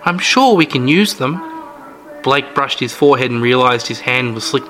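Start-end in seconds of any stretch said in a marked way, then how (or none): 0.0-1.4 put-on high, chirpy old-lady voice; sweet old lady. Old hostess brags about her jam, sweet high voice. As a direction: play the sugary granny host, all charm. Mrs Anders on the phone.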